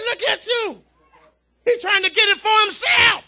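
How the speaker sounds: high, delighted father voice; high-pitched proud exclaiming; proud amazement. Retold or imitated. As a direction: re-enact a parent thrilled at baby's progress. Proud father watching baby feed himself.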